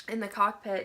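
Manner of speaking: ordinary talk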